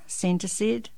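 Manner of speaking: ordinary delivery, nothing marked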